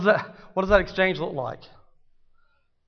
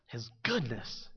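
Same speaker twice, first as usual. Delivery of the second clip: tender hush; 5 semitones lower, 11 dB quieter, slower than usual. Real, not acted. Tender repeat after question.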